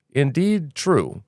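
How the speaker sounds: ordinary talk, nothing marked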